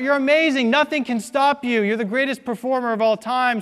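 high, loud cheering crowd; crowd shouting. Retold or imitated. Crowd cheering Blondin after the wheelbarrow crossing, loud and high. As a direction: roar praise like a thrilled crowd. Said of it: "And they shout".

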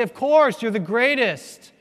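high, loud crowd reply; crowd cheering. Retold or imitated. Crowd answering the acrobat, high and loud. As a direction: yell it back with total confidence. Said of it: "And they say".

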